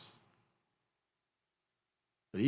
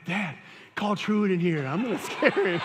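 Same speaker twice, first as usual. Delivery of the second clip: gleeful retelling; 7 semitones higher, 6 dB louder than usual. Retold or imitated. Daughter gleefully retelling the prank at family dinner, laughing. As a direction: relish every word like a proud prankster. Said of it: "She's like".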